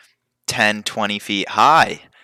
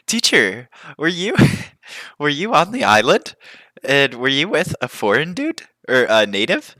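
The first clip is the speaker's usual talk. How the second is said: put-on curious kid; 8 semitones higher, 5 dB louder than usual. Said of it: "He's like".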